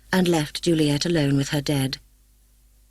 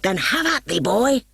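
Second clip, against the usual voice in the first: fierce challenge; 6 semitones higher than usual. A narrator's reading. Sword fight challenge.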